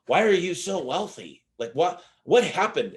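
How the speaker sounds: acted amazement. Man asks his wealthy self what happened, acted amazement. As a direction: play someone baffled and wanting answers.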